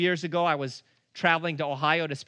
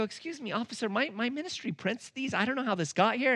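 higher, softer nervous pleading; nervous explanation; 6 semitones higher, 3 dB quieter than usual. Retold or imitated. Preacher to the police officer, nervous and softer. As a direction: talk your way out, a little scared. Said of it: "I look up and I go".

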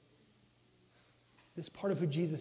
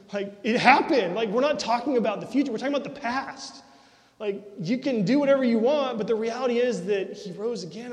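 loud, driving sermon delivery, higher and louder; full-force preaching; 5 semitones higher, 7 dB louder than usual. Acted. Pastor preaching to his congregation with full-force conviction. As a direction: preach it out to the whole room.

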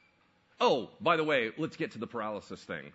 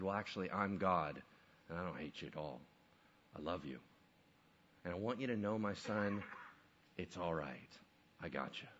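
tender reassurance; 7 semitones lower, 7 dB quieter than usual. Acted. Jesus speaks to paralyzed man.

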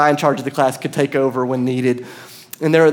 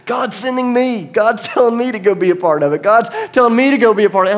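higher voice, building louder as it repeats; excited realization; 8 semitones higher, 4 dB louder than usual. Retold or imitated. Friend back from praying announces his calling, excited. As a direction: retell a friend's dawning, urgent calling.

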